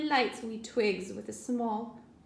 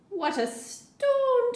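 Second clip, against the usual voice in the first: high, slow, birdlike trill; twittering wren; 6 dB louder, slower than usual. Acted. Wren exclaiming about the storm in a high twitter. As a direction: twitter it like a startled little wren. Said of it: "twitters wren".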